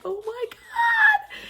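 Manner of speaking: high-pitched slow squeal of delight; squealed oh my god. Retold or imitated. Unboxing a cute package, squealing with delight. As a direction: squeal it like a thrilled fan. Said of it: "I was like".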